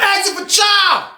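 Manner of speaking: high, hyped-up shouting; excited shout. Retold or imitated. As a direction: yell it out like the crowd. Retelling the crowd's shouts.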